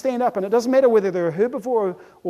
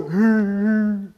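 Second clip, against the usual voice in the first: drawn-out fake titter; numb giggle. Human numbed by junk food, hollow mock giggle. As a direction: giggle emptily.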